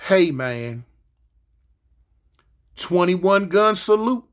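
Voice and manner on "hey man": ordinary talk, nothing marked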